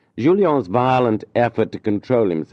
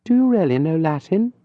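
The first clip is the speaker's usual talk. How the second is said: higher, lightly voiced lady, curious; a refined lady asking, pitched up; lady's voice; 10 semitones higher than usual. A narrator's reading. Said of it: "lady asks tutor a question".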